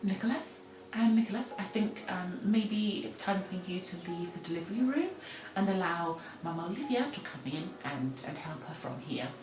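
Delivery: hushed and gentle, easing the husband out; soft tactful doctor. Retold or imitated. Doctor gently sending the husband out, hushed and careful. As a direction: tiptoe around it quietly, a delicate suggestion. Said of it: "So she was like".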